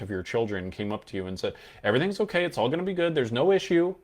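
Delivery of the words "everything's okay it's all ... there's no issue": very high, forced reassurance; a loved one hiding bad news in a high voice; suspiciously cheerful. Shown as a demonstration. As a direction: sound too bright to be believed. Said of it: "If they started talking like that".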